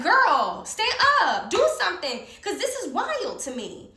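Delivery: high, loud, exasperated demand; indignant urging. Retold or imitated. Acting out urging her lawyer.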